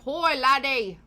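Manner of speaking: high, loud mock Scottish call; put-on Scottish accent, called out; Scottish granddad. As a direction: imitate a Scottish grandfather hailing a lad. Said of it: "when Liam's granddad's like".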